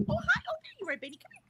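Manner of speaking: high, gushing pet voice. Real, not acted. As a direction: coo at the dog. Greeting the dog Benny.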